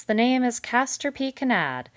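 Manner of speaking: loud, hearty big-fellow voice; hearty, loud beaver voice. A narrator's reading. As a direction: boom it out like a jovial salesman. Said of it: "with his usual heartiness".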